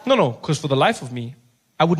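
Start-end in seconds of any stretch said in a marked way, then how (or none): none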